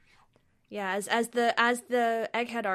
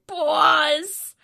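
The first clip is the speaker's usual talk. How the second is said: mock scream and cry; 8 semitones higher, 11 dB louder than usual. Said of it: "scream and cry".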